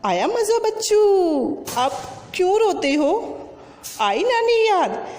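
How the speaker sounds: high, teasing told-you-so; gloating ant. Acted. The little ant taunts the beaten elephant, high gleeful gloat. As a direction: crow over the crying elephant. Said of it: "तब चींटी बोली".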